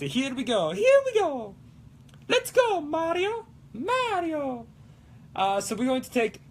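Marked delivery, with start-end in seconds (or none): 0.0-0.7 put-on catchphrase in ordinary-pitched Italian accent. Starting off for live stream viewers, Italian accent. First, lower take of catchphrase. 0.7-1.5 very high cartoon Italian plumber; Mario. Rallying stream viewers, high Mario voice. 2.3-4.7 high-pitched cartoon Italian plumber; Mario. Greeting viewers as a game plumber, high Mario voice.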